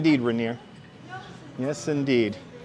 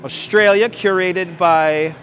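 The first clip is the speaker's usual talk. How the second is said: mock announcer; 3 semitones higher, 5 dB louder than usual. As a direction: present it like a game-show host unveiling a prize. Announcing next country display.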